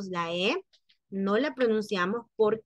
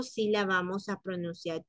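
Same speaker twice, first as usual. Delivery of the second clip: ordinary talk, nothing marked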